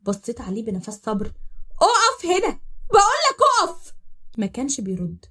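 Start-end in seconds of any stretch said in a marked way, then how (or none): 1.8-3.9 صرخة عالية وحادة وهي تطلب منه يوقف; اصرخي بأعلى صوتك: اقف; صراخ غاضب. Acted. تصرخ عليه يوقف العربية. (صراخ غاضب عالي)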